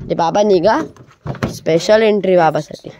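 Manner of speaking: usual speaking voice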